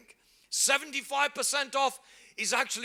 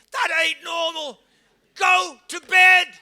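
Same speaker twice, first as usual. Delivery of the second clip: loud rebuke, slow and much higher; shout; 6 semitones higher, 11 dB louder than usual. Real, not acted. Preacher shouting at late-night shoppers, loud rebuke. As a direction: bellow it at the congregation.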